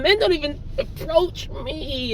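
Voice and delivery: slow, soft mocking whine of a woman; lightly voiced, mocking women who complain. A man mocking women who say men ignore them, soft slow whine. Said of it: "you got women complaining".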